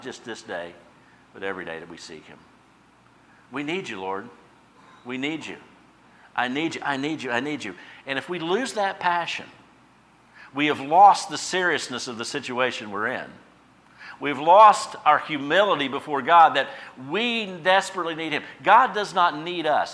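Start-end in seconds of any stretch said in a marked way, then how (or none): 3.5-7.7 earnest pleading prayer. Acted. Preacher praying to the Lord before the congregation, earnest pleading. 8.0-19.9 louder, higher full-force preaching. Acted. Pastor warning the congregation, loud fervent preaching.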